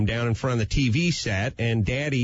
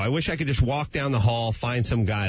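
normal talking voice

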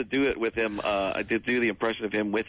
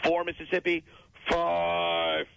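counting Mississippis as Jim Rome; 5 semitones higher than usual. Acted. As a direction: count it out like a cocky host. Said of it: "count out loud the five Mississippis".

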